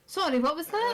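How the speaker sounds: mock-polite, thrown request to repeat; baffled server. Server thrown by a Scots accent, high puzzled query. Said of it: "they look at you and they go".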